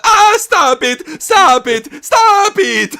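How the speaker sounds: high, slow whiny shriek of stop it; mock crying plea. Santino begs while being beaten, in a whiny shriek. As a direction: shriek like a beaten wimp begging.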